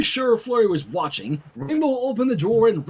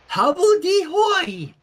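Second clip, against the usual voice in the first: put-on high, slow, loud baby word; toddler voice; 12 semitones higher, 5 dB louder, slower than usual. Flurry repeating the silly word, high baby squeal.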